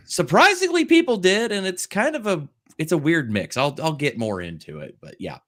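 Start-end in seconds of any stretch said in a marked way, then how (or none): 0.1-2.5 much higher, louder start; surprised lift. Real, not acted. Streamer pitches up, surprised viewers watched it. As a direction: pop up high on surprisingly.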